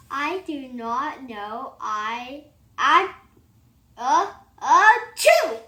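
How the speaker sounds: slow words climbing into a loud high sneeze. Acted. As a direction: build into an achoo. Acted sneeze build-up and sneeze.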